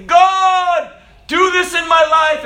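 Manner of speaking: very high, loud pleading cry to God; mock desperate prayer. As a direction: ham up a begging believer.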